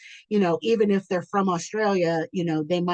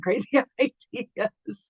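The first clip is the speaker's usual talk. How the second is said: laughing through tears; 6 semitones higher, slower than usual. Real, not acted. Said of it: "I'm a crier".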